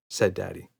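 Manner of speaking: ordinary delivery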